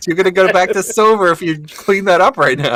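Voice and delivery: fast, high, laughing scold; cracking-up high rant about losing. Retold or imitated. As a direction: push the words out fast through laughter.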